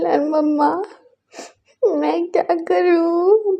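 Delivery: high, very slow tearful wail; drawn-out helpless sob; crying plea. Acted. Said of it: "बोल के वो रो रहा था". (धीमी रोती हुई पुकार)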